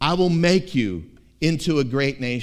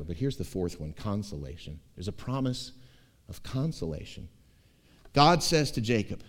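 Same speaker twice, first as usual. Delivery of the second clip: normal talking voice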